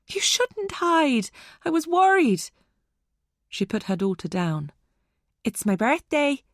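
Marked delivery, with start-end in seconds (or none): 0.1-2.5 high, drawn-out worried reproach; distressed scolding. A narrator's reading. Mother scolding her found daughter, distressed. 5.4-6.4 put-on high little-girl voice; child. A narrator's reading. Daughter telling Dylan about her birthday, childlike. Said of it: "Rachel said".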